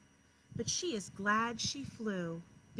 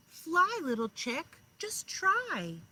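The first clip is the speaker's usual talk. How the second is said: louder, higher coaxing; father owl urging; 6 semitones higher, 7 dB louder, slower than usual. A narrator's reading. Father owl coaxing his chick to try, louder. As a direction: cheer her on to try. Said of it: "said her father".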